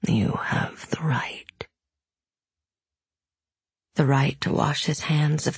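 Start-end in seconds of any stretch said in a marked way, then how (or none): 0.1-1.4 low unvoiced demon whisper. A narrator's reading. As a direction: hiss it softly as a tempting demon.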